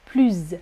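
"plus" as pluzz, buzzed ending; final Z sound. Shown as a demonstration. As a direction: say a falling "plus" with a voiced Z. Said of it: "Comme un moustique".

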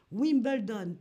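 "Wimbledon" with a French accent. Shown as a demonstration. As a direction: give the English name French vowels and a French accent. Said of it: "toujours cet accent français". (« Wimbledon » avec l'accent français)

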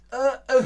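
high, loud panicked "äh, äh"; mock drowning noises; drowning splutter. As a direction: gulp and splutter as if going under. Acting out water at the neck.

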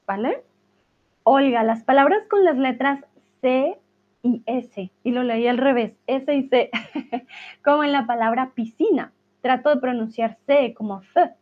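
normal talking voice

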